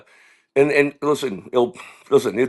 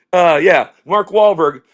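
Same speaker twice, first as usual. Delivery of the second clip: usual speaking voice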